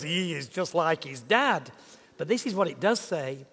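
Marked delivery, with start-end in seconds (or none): 0.0-1.7 put-on toned-down Yorkshire accent at an everyday pitch. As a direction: repeat it in milder, quicker Yorkshire. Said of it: "never quite puts it in those words".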